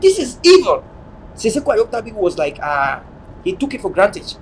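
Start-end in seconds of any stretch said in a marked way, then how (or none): 0.0-0.8 loud, higher-pitched denunciation; raised voice, pressing the accusation; emphatic warning. Retold or imitated. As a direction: call it out loudly as a warning. Retells their warning emphatically.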